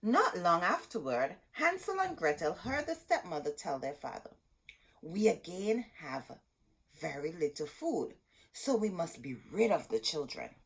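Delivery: put-on lively read-aloud to kids; storybook reading. A narrator's reading. Teacher reading Hansel and Gretel aloud to children. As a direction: read the tale with gentle drama.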